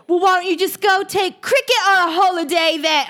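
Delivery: high-pitched mock British accent; hammed-up, high British accent; botched British accent. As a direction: attempt Australian, land in British. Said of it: "I keep doing a British".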